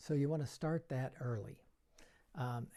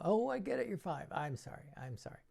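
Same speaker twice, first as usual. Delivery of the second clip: mock apology; 6 semitones higher, faster than usual. Shown as a demonstration.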